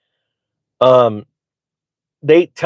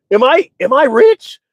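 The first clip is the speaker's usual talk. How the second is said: high, slow mock disbelief; drawn-out fake realization; feigned wonder; 12 semitones higher, 4 dB louder than usual. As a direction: pitch it way up, playing innocent.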